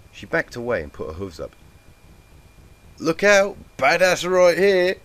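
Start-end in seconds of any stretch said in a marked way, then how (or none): 3.0-5.0 loud, high, slow mock boast; sarcastic. A narrator's reading. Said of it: "she mumbled sarcastically".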